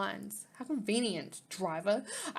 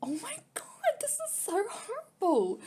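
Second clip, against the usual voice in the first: upset; 11 semitones higher than usual. Real, not acted. Reacting to sad story scene.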